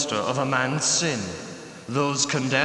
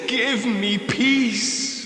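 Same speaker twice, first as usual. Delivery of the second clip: pleading; 5 semitones higher, much slower than usual. Acted. Ghost begging for rest.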